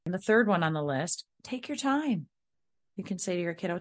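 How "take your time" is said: high, lilting permission falling at the end; airy, high-pitched and unbothered; breezy parent. Shown as a demonstration. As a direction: sing it out lightly to a child. Models breezy response to child.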